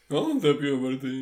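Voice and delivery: loud, high, slow mimicry; mock puberty voice. Co-host teases host about puberty, mock puberty voice.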